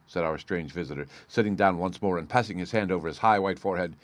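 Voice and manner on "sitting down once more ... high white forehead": ordinary delivery, nothing marked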